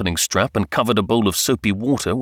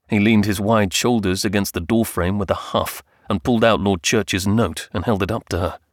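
ordinary delivery, nothing marked

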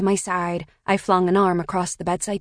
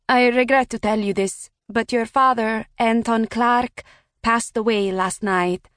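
put-on higher, Italian-accented woman on the phone; thick Italian accent; 4 semitones higher than usual. A narrator's reading. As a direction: give her a thick Italian accent, polite and formal. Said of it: "A woman with a thick Italian accent".

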